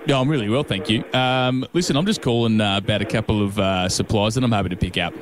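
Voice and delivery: low, broad Aussie tradie; easygoing Aussie ocker bloke. Pretend. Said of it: "put on a bit of an ocker voice".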